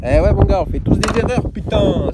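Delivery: loud, high, rueful outburst; half-laughing yell of frustration; loud exclamation. Real, not acted. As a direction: shout it out, laughing at your own mistake. Rueful outburst about his mistake.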